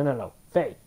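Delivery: ordinary talk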